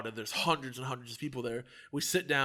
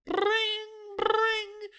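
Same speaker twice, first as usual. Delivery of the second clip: high, trilled phone ringing; imitated phone ring; 3 dB louder than usual. Phone goes off during the sad scene, high imitated ring. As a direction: mimic a ringtone interrupting.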